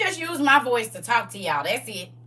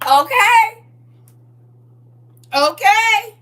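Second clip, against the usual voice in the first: high, loud NPC okay; 13 semitones higher, 13 dB louder, much slower than usual. Pretend. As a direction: repeat like a game character. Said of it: "my version of the N P C".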